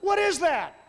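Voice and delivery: loud, high bewildered question; hammed-up confusion; mock bafflement. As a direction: pretend to be baffled. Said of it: "You're like".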